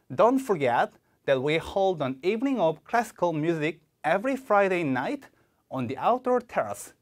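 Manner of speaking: very slow with "Don't" hit hard and a break before "that"; slow exam model reading with phrase breaks; stress on DON'T. Shown as a demonstration. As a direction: punch "Don't", pause before "that", read slowly. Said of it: "Don't 세게 읽어주셔야 되고요".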